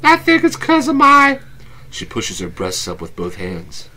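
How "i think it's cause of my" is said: Abby, teasing, pitched way up; playful date voice, high and bright; high girly voice. Acted. S0 holds Abby voice.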